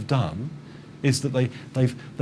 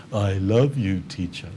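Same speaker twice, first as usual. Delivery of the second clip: a flat, lifeless "I love you, teacher" fading away; bored flat voice; 3 semitones lower, slower than usual. Shown as a demonstration. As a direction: sound totally uninterested. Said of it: "really bored and they say".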